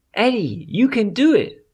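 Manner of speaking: high, rising chorus-like fairy cheer; rally him with high excited encouragement; cheering butterflies. Acted. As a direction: butterflies cheering a scared boy forward.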